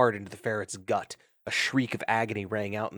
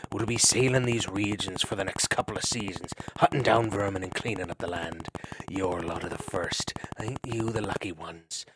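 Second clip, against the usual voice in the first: menacing growl; 3 semitones lower than usual. Acted. Otter threatening captured ferret, a low menacing growl. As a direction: growl over the axe blade. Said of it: "growled in a menacing voice".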